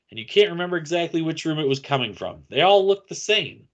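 ordinary delivery

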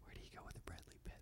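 breathy whispered aside. Real, not acted. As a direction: whisper the question to yourself.

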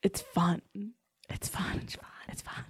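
very soft, repeated whisper; fading whisper. Real, not acted. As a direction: whisper it away to almost nothing. Fading repeated reassurance after bad improv.

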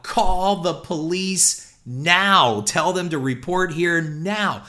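screamed order. Retold or imitated. Manager repeating his order to the box office, slow loud scream. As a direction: scream it so nobody can miss it. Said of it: "I screamed it out loud".

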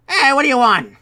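annoyed kobold. Acted. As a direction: brush the stranger off. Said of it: "another one says".

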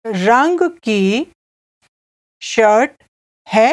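broken into small pieces with long pauses; slow repeat. Shown as a demonstration. Teacher has listener repeat the buyer's question, slowly. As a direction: dictate it slowly for learners to echo. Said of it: "I'll say it again slowly".

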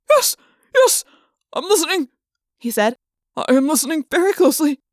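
high, fast, terrified; frantic agreement. Acted. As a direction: babble yes to save yourself. Trainer begging under threat.

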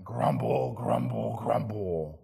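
deep, low rumbling grumble grumble grumble; mock grumbling. Shown as a demonstration. Preacher imitating the people's grumbling, very low. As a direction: growl the word grumble deep in the throat. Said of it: "You can hear the murmuring".

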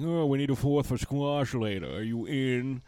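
stuffy fake British club voice; upper-crust British, nose in the air; snooty British accent. Friends at the club, a snooty British accent. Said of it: "snooty and, and stuffy".

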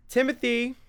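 high, loud call from another room; a mother's sharp call of her son's name. Acted. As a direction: yank him back to reality with a shout. Mother calls boy out of game.

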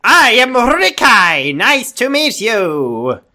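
slow, high, hammed-up introduction; new character voice. Co-host introducing a new story character, hammed-up voice. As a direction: stretch it out in a big cartoonish put-on voice. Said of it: "New character".

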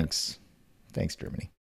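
whispered aside. Real, not acted. Sarcastic aside about German title.

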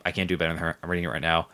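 ordinary talk

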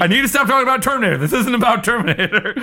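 high, fast, laughing self-scolding; exasperated outburst at oneself, half laughing; yelling at myself. Real, not acted. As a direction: tell yourself off in a high, worked-up voice. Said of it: "I'm yelling at myself".

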